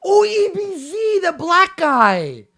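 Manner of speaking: high, loud burst of recognition; loud realization. Real, not acted. Host realizing which incident his guest means, loud realization. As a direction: draw it out high, then let it fall.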